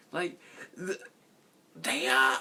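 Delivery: high-pitched stammering imitation; Nostalgia Critic. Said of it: "Nostalgia Critic impression".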